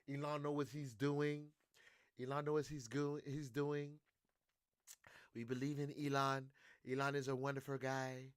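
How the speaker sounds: soft, mock Italian-accented Trump; lightly Italian-accented praise; accidental Italian accent. Trump defending Elon, in a soft accidental Italian accent. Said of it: "why did that sound so Italian?".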